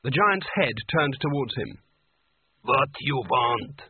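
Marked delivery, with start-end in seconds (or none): 2.6-3.8 slow, broken giant voice; slow ice giant. A narrator's reading. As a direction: speak like a huge ice creature.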